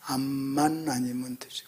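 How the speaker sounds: very soft, slow, low refrain; imitated dying pastor. Pastor with cancer repeating his saying, hushed refrain. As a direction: draw it out low and very quietly. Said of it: "항상 하는 말이 있어요".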